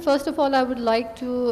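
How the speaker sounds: normal talking voice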